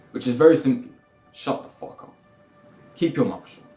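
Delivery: ordinary talk, nothing marked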